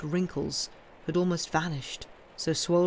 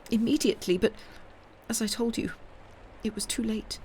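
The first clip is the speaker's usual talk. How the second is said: put-on higher old woman's voice, worn and sorrowful; grieving old marchioness; 6 semitones higher, faster than usual. A narrator's reading. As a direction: tell the death in a hushed, shaken voice. Marchioness answers.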